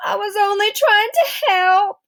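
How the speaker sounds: high, teary whine, breath catching; whimpering. Acted. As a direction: play the pitiful wronged son. Boy fakes an asthma attack.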